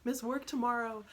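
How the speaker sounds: lightly acted tearful protest, without real sobs; imitated crying child. Upset son worrying mom will lose her job, imitated crying protest. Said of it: "he's crying. He's like".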